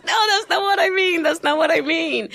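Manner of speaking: high, squeaky words breaking with laughter; laughing through it. Retold or imitated. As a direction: imitate him cracking up mid-protest. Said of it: "He goes".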